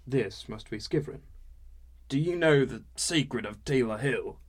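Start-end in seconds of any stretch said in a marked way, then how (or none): 2.1-4.4 put-on loud drunk storyteller voice; drunken mystery. Skivrin teasing a secret to the newcomer, drunk and loud. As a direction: lean in with boozy mystery.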